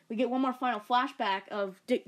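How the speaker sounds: ordinary talk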